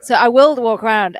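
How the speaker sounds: normal talking voice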